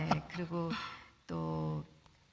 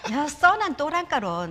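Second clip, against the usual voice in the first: slow, loud Gyeongsang dialect psalm; 3 semitones higher, 7 dB louder, slower than usual. Pretend. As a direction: drag it out in broad Gyeongsang dialect, grinning. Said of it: "경상도 버전". (경상도 사투리로 느리고 크게 시편 낭송)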